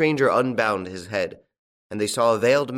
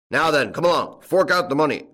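loud, high, bullying order; rough farmer shouting orders; barked demand; 5 semitones higher, 7 dB louder than usual. A narrator's reading. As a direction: demand it forcefully. Farmers seize and threaten the tramp.

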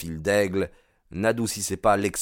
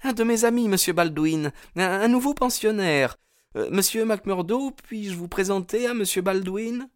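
very high, anxious female voice; panicked politeness in a high-pitched voice; flustered young woman; 14 semitones higher than usual. A narrator's reading. Hetty greeting the suitor who arrives early, flustered. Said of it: "affolée".

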